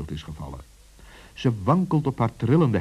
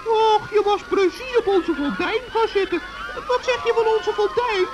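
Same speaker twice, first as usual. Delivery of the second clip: very high, chirpy little gnome; a tiny forest gnome, all innocence; high gnome voice teasing the witch; 4 dB louder than usual. Acted.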